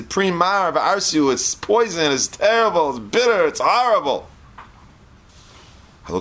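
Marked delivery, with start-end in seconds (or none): 0.0-4.3 hammed-up scream of protest; mock howl. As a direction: howl it like a child spitting out sour fruit. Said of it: "scream, howl".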